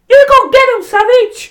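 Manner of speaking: high, loud fan yell; excited kid cheering. Retold or imitated. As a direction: scream like a kid ringside. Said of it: "I am that little boy that's like".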